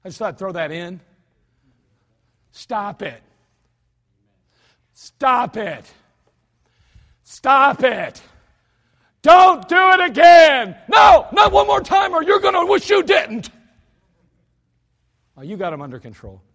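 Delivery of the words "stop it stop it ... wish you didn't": builds from calm warning to a high furious shout; yelling at kids. Acted. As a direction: escalate step by step until you scream at the children. Parent yelling at unruly children.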